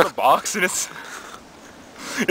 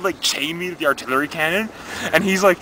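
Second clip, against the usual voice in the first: ordinary talk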